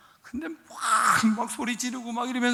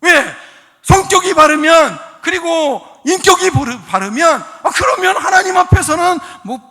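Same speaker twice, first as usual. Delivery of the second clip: loud, raised-pitch exhortation; full-force preaching; 7 semitones higher, 13 dB louder than usual. Real, not acted. Preacher reasoning with the congregation about character, loud and forceful. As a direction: drive each phrase out to the hall.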